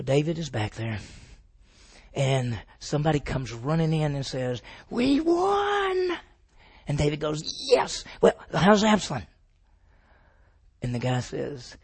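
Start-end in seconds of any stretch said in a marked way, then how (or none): 4.9-6.2 high, thrilled cry of victory; excited shout. Retold or imitated. Messenger bursting in to tell David, an excited high cry. 7.7-9.2 eager question. Retold or imitated. David hearing the battle news.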